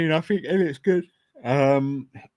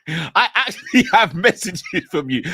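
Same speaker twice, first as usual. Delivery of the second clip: laughing; 4 semitones higher, 3 dB louder, slower than usual. Real, not acted. Repeats line while laughing.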